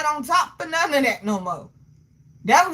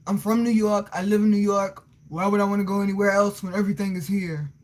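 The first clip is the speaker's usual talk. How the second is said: mock Brooklyn guy; 5 semitones lower than usual. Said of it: "the guy I knew from Brooklyn said".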